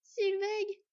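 high, loud cry of a name; high cry choking up; desperate cry. Acted. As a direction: call out to your sister. Sister cries out for Sylveig.

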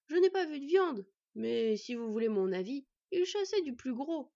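ordinary delivery

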